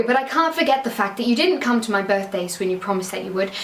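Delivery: ordinary delivery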